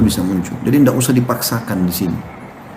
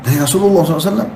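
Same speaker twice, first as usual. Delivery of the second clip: shocked question; 7 semitones higher, much faster than usual. Retold or imitated. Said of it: "Kaget orang itu".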